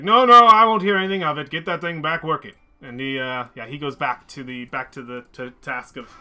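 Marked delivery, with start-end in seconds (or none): 0.0-2.5 loud, commanding Chultan harbor master; overruling complaints. Acted. Harbor master overruling complaining workers, loud and firm. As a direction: burst out loudly, cutting off the grumbling. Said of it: "And he's like".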